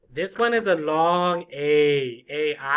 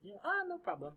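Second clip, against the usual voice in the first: small, soft, higher-pitched friendly voice; cheerful and quiet; 8 semitones higher, 13 dB quieter, faster than usual. Acted. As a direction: greet shyly, very softly and lightly. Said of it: "Cheerful and quiet".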